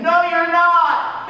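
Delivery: high, slow, loud refusal; yelling back. Acted. Captain yelling back at Jonah, high and loud. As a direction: shout him down.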